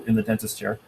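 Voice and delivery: high whining dentist drill noise on "in the dentist chair"; dentist drill imitation. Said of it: "that's my best dentist drill".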